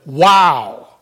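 loud, punched exclamation of amazement; big emphatic "wow". Shown as a demonstration. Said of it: "has emphasis. It's a, it's like".

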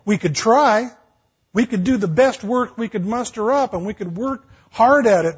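loud, driving pulpit voice; fiery sermon delivery building to its peak; full-force preaching. Acted. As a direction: preach it out to the back pews.

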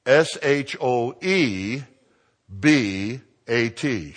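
letters spelled out slowly; slow spelling. Shown as a demonstration. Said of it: "spells the name again".

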